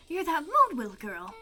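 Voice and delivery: usual speaking voice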